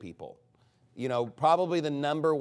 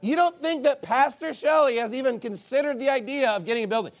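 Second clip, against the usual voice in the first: sarcastic retort; 9 semitones higher, 3 dB louder than usual. Retold or imitated. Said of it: "And it's like".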